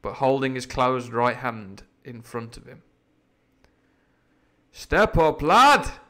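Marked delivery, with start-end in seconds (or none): 4.7-6.0 louder, higher call to the pirate; cried out. Acted. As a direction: call him forward across the room. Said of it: "cried Silver".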